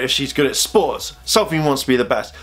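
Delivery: usual speaking voice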